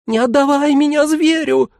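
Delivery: put-on very high childlike howl of terror; panicked high-pitched squeal; wailing mannequin. A narrator's reading. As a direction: wail it in a tiny, piercing voice. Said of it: "взвыл манекен".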